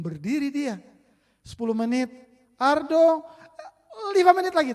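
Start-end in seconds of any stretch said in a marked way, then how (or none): 2.6-4.7 loud, higher call across the house; mother calling. Retold or imitated. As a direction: call the son from the kitchen.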